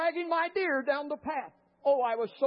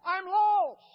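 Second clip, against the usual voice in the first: higher-pitched acted call for help; retold cry for help; 5 semitones higher, 3 dB louder, much slower than usual. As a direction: call out as if lost in the woods. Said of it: "I begin to cry".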